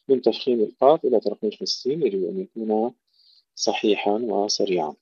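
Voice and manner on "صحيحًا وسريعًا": ordinary talk, nothing marked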